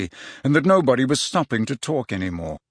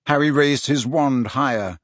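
ordinary delivery, nothing marked

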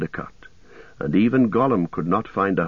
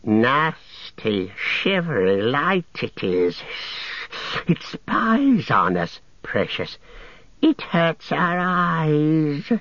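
slow, higher creature hiss; hissed Gollum voice; 7 semitones higher, much slower than usual. A narrator's reading. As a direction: spit out the hatred of the moon. Gollum voice held.

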